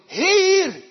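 loud, high sharp shout; policeman calling. Retold or imitated. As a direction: stop a driver at the light. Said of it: "en die roept".

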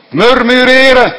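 high, loud exclaimed word; loud cry. Acted. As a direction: call it out loud. Preacher builds repeated word. (luid uitgeroepen)

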